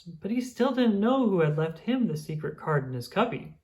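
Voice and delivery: usual speaking voice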